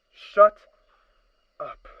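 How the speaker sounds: high, loud shout at the voices; yelling. Acted. Lone survivor yells at the whispering voices, furious. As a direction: bark it at nothing.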